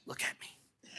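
whispered, soundless command to the class; teacher whisper. Shown as a demonstration. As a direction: hush them like a substitute teacher. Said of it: "I used to be a substitute teacher".

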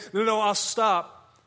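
a hint of a frightened man backing down; lightly voiced martyr who gives up, slow; mock recanting. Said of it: "instead of stopping and saying".

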